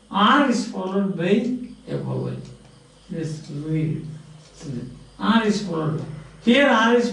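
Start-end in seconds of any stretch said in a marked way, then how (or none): none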